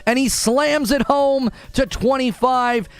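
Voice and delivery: high, slowed sports-caller hype; hype announcer. Real, not acted. Streamer calling out a donation like a game announcer, high and hyped. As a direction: call the donation like a slam dunk.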